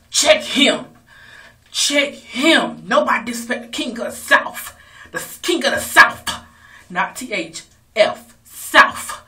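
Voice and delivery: loud, high hype-woman voice; hyped-up mama. Retold or imitated. Mother cheering her son on to fight, loud and high. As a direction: shout it like a proud mama egging him on. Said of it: "She was like".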